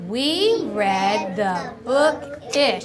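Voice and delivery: long, stretched words; slow choral reading. Shown as a demonstration. Teacher leads class through the message, slow and drawn-out. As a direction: lead readers word by word, slowly.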